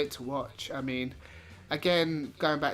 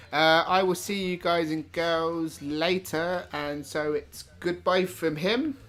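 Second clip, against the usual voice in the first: showy sign-off; 3 semitones higher, 5 dB louder, slower than usual. Acted. Hammed-up show sign-off.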